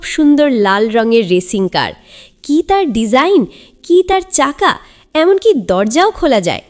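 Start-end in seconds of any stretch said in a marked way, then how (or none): none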